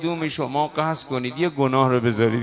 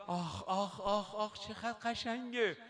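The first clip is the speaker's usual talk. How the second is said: much softer, savoring ah ah; soft sigh of delight; 3 semitones higher, 14 dB quieter than usual. Real, not acted. Preacher savoring the beauty of the hadith to the hall, soft. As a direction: breathe it out in quiet awe.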